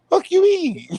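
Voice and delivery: high, loud incredulous outburst; outraged yell. Real, not acted. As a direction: shriek it in disbelief. Outraged at sandwich strip rule.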